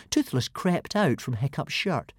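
plain reading voice

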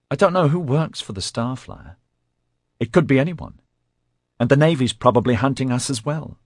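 high, fretful nervous voice; paranoid male voice. Acted. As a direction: sound high and jittery.

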